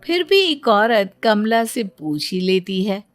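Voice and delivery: ordinary delivery, nothing marked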